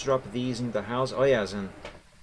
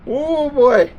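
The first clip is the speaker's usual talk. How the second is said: loud, high cries of alarm; rattled exclamations; 6 semitones higher, 11 dB louder, much slower than usual. Real, not acted. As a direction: cry out, shaken by a scare. Rattled by the ghost event.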